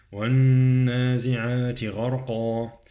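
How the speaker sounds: recited "والنازعات" with long humming nun, heavy qaf in "غرقا"; ghunnah and tafkhim. Shown as a demonstration. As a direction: hold the nun of "والنازعات", darken the end of "غرقا". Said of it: "عندي غنة فوق النون".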